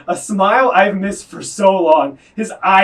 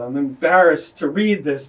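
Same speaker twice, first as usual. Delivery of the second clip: normal talking voice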